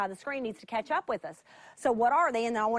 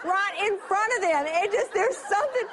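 overjoyed, can barely hold it in; laughing delight; 7 semitones higher than usual. Real, not acted. Preacher to congregation about holy charades, laughing with joy.

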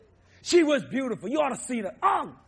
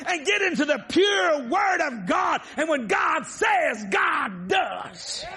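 preaching; 5 semitones higher than usual. Acted. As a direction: preach it out to the congregation. Preaching at full force.

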